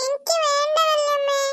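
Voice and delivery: slow, tearful no; crying refusal. Acted. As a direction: refuse the food while still sobbing. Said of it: "കരയല്ല മോളെ". (കരഞ്ഞുകൊണ്ട് പതുക്കെ വേണ്ടെന്ന്)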